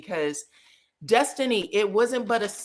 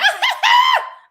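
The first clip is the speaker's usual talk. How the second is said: high shrieking cackle, imitating Tisha; imitated cackling shriek; loud cackle; 9 dB louder than usual.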